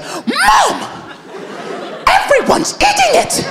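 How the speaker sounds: put-on high, shrieking kid yell; kid's outburst. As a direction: snap at mom like a fed-up child. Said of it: "He was just like".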